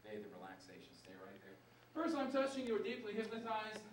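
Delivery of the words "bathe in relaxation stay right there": low, soft trance voice; hypnotist induction. Acted. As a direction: sink them down gently.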